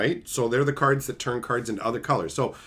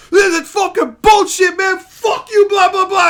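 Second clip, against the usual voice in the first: much higher, loud mock rant; imitating a furious friend; 11 dB louder than usual. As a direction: throw a tantrum over the cards. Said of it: "He fucking throws the cards out".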